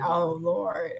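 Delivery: ordinary talk, nothing marked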